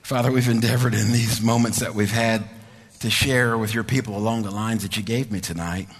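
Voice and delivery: low, soft, unhurried prayer voice; closing prayer. Real, not acted. Pastor praying softly to Father God for the listeners. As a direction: pray gently over the congregation.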